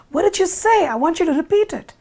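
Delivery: high, urgent, startled demand; alarmed. Acted. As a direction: demand it sharply, as if jolted awake. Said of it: "is absolutely alarmed".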